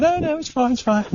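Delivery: high, breezy dismissive woman voice; mimicking dog owner. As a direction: wave off the worry, pitched high. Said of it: "she went".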